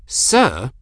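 arch, affronted query; haughty questioning lilt. A narrator's reading. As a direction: throw the word back archly. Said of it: "asked in an arch tone".

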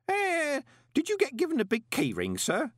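high, innocent-sounding query; feigned innocence in a high voice; trembling innocence. Acted. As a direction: ask it all innocently, pitched right up. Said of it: "his voice trembling with innocence".

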